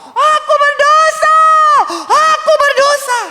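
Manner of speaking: shouted, very high, slow cry of guilt; David's confession. Retold or imitated. King David confessing his sin to Nathan, shouted and high. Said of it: "Dia bilang apa?".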